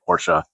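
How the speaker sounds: "Porsche" said "POR-shuh"; "Porsche" in two syllables, stressed on the first. Real, not acted. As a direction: sound the final e of "Porsche" as uh. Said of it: "I guess I'm supposed to say Porsche".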